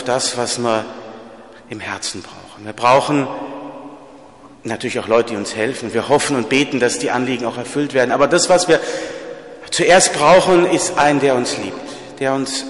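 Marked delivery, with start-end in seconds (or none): none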